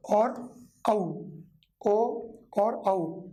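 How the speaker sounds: ओ and औ each stretched out very slowly and kept apart; slow vowel demo. Shown as a demonstration. Teacher contrasting ओ and औ for students, very slow. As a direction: draw out ओ and औ very slowly to contrast them. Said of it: "गौर से समझ लेना चाहिए".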